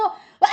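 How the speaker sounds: loud acted yell of alarm; short, loud mock scream; startled scream. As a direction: cry out in sudden shock. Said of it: "하고 소리를 지릅니다".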